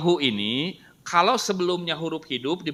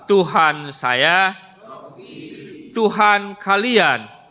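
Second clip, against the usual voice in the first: slow drill recitation; 5 semitones higher, much slower than usual. Shown as a demonstration. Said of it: "Saya baca bahasa Indonesianya".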